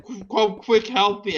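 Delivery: high, loud stammering plea for help; mock panic. [name] pretending to be in danger, mock panic. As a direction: play a hammed-up terrified call for help. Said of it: "It's like".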